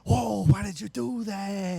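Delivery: high, drawn-out disbelief; mock dismay. Manager asking why worker quit, imitated dismay. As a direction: imitate a stunned manager, stretching the words.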